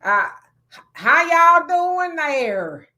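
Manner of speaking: high, loud, slow Texas drawl greeting; Texas country accent. Shown as a demonstration. Asked how Texans talk, he hams up a loud Texas accent. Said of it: "how does Texas".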